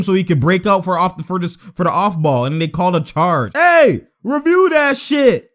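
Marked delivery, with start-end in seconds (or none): 3.5-5.5 drawn-out, high holler at the refs; slow, insistent yell; shouted demand. Real, not acted. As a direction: call out indignantly for a review. Demands review again.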